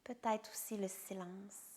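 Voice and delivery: calm meditation guide with long pauses between phrases; guided meditation. Acted. Yoga teacher guiding a resting listener through yoga nidra, soothing meditation voice. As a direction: guide them into rest, phrase by phrase, leaving silences.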